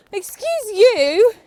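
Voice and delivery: high, loud, drawn-out telling-off; indignant scolding. Real, not acted. Rider to her misbehaving horse, indignant scolding.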